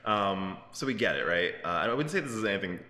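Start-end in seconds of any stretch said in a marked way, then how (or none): none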